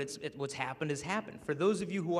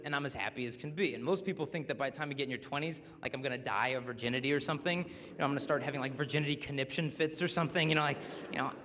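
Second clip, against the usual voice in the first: ordinary talk, nothing marked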